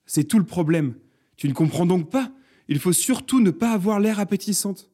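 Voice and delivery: loud, high insistence; impatient, climbing in pitch; exasperated explaining. A narrator's reading. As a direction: drive the point home. Josette argues with her slow neighbour.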